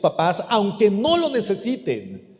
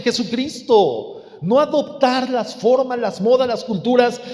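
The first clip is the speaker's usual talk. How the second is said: raised, high-pitched pulpit exhortation; impassioned preaching; 5 semitones higher, 3 dB louder than usual. Real, not acted. Preacher warns against conforming to the world, impassioned preaching. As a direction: pile up the list with force.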